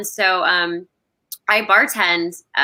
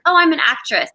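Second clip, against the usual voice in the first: higher-pitched, eager self-introduction; naive newcomer; 7 semitones higher, 3 dB louder than usual. Retold or imitated. Newcomer telling people her job, naively proud. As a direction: play up the naive new actress.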